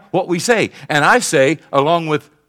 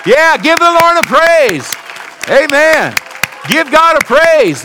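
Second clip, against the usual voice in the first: preaching at full force; 8 semitones higher, 6 dB louder than usual. Real, not acted. Pastor proclaiming the mortgage paid, jubilant shout. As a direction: shout the victory to the congregation. Pastor announces debt-free church.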